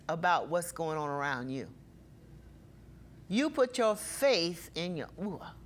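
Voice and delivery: ordinary talk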